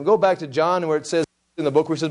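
ordinary delivery

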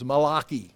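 Malachi said as mah-LAH-kee; Hebrew pronunciation. Shown as a demonstration. Teacher showing a Hebrew word, Malachi as mah-LAH-kee. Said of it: "Tyler's and my Hebrew words".

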